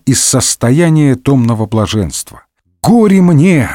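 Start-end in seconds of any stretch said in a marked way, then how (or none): 2.8-3.7 higher-pitched mock wail; woe-is-me cry, lightly acted; theatrical lament. A narrator's reading. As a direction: lament as if all is lost, hammed-up. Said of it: "запричитал наш герой".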